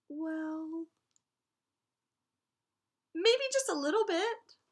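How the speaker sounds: put-on high, far-off sheepish child voice; sheepish little dinosaur. A narrator's reading. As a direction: squeak out the guilty admission. Sheepish child answer in story.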